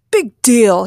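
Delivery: loud dismissive outburst; loud, unimpressed yell; scornful shout. Acted. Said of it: "he shouted".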